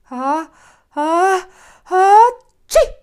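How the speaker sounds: ha-ha-ha-tschi sneeze, big finish; dramatic sneeze; building sneeze. Acted. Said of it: "nieste Herr Maulbeer".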